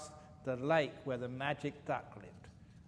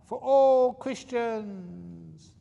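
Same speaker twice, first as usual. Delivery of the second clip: slow, high ringing battle cry; cries out; 8 semitones higher, 6 dB louder, much slower than usual. Acted. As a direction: shout it out like a heroic last call. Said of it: "he cries out".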